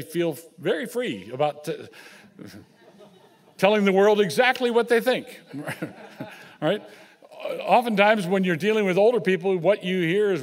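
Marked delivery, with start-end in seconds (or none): 3.6-6.8 laughing. Real, not acted. To the congregation about blunt old folks, laughing. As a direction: chuckle through the words.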